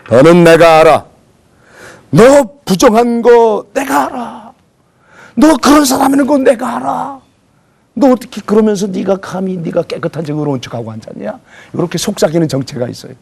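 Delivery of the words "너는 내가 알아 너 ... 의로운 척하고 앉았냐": whispering devil. Acted. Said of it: "원수 마귀가 우리를 찾아와서 속삭입니다".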